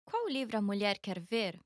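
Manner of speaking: ordinary talk, nothing marked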